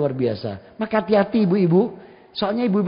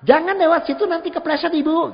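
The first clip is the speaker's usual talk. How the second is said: raised, high-pitched caution with even intonation; high tone, not angry; 9 semitones higher, 3 dB louder than usual. Shown as a demonstration. Child steering a mother off a slippery path, high but not angry. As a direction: lift the voice high, keep the anger out. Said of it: "Jadi nadanya tinggi, tapi intonasinya sama".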